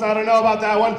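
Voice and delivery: normal talking voice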